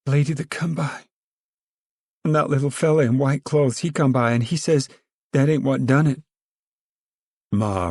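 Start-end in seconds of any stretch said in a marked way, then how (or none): 0.1-6.2 put-on girlish voice, pitched higher; timid young woman answering her mother; a lightly voiced young girl; young girl's voice. Rose of Sharon answers Ma.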